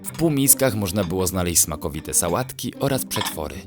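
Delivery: plain reading voice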